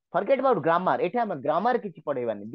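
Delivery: ordinary talk, nothing marked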